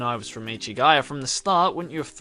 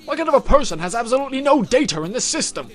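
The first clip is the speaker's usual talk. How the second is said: loud, high, angry cop interrogating; young detective grilling a suspect, loud and angry; gruff detective; 9 semitones higher, 7 dB louder than usual. Acted. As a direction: bark questions at the suspect.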